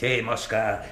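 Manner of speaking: ordinary delivery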